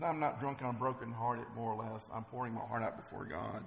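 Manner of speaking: soft, low retold confession; subdued, brokenhearted. Brokenhearted woman explaining herself to the priest, softly subdued. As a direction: answer quietly, with understated hurt. Hannah voiced, answering as brokenhearted.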